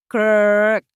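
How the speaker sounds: loud call over a crackly radio; walkie-talkie call. Acted. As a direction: shout the name through a walkie-talkie.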